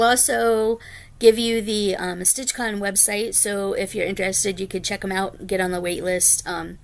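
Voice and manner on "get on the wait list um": ordinary delivery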